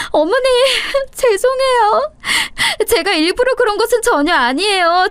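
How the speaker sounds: high, wheedling plea with a nervous laugh; begging daughter-in-law. Acted. Kneeling daughter-in-law begging her mother-in-law's forgiveness, high and wheedling. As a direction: grovel sweetly to save yourself. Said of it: "무릎을 꿇고 저에게 빌기 시작했어요".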